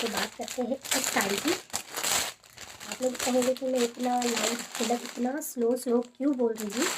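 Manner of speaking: slow, hushed words so the sleeping husband isn't woken; slow and quiet, careful not to wake someone; slow soft talk. Real, not acted. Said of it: "मैं slow इसीलिए बोल रही हूँ".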